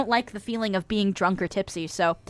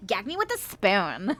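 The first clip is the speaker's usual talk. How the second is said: put-on slow, softer Valley Girl drawl; Valley Girl accent; 9 dB quieter than usual. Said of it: "my Valley Girl impression".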